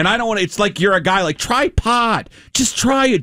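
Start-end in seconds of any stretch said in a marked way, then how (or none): 1.4-3.2 high-pitched mock coaxing; peer-pressure voice. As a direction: mimic a pushy friend egging someone on. Mimicking a peer-pressuring guy.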